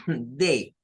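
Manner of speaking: "Day" with the A vowel, dei; day with A sound. Shown as a demonstration. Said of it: "su pronunciación normal sería así".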